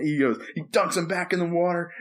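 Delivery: usual speaking voice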